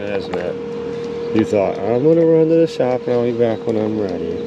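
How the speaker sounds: loud dog-owner chatter; talking to a dog. Real, not acted. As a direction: scold and praise the pup.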